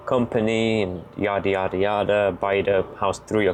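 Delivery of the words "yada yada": normal talking voice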